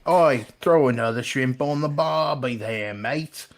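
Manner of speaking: drawn-out cartoon Australian; slow, hammed-up Aussie call; mock Australian accent. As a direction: lay on a thick Australian accent. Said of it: "a very thick Australian accent".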